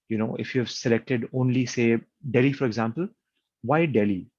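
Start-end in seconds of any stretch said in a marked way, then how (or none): none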